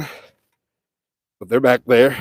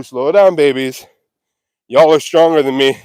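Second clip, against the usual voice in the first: ordinary talk, nothing marked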